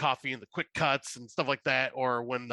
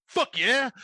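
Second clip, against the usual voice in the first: imitating Nick Frost; 10 semitones higher, 6 dB louder than usual. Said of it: "Nick Frost just goes".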